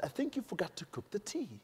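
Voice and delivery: very soft, tactful complaint; hushed, careful British politeness; delicate polite correction. Acted. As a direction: break it to the hostess softly. Politely tells hostess of mistake.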